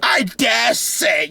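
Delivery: slow, drawn-out snooty nerd voice with a laugh; mocking One Piece nerd. Mocking a smug One Piece fan replying to the channel, slow and snooty. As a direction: lay it on thick like a smug fan. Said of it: "They're gonna be like".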